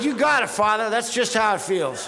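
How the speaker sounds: mock sorry-for-myself whine; whiny self-pity. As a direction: play the poor victim to the priest. Self-pitying answer to the priest.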